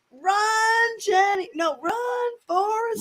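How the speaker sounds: high-pitched call to run; mock urgent, high call; calling out film line. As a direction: cry it out like a movie quote. Acting a film quote, calling out.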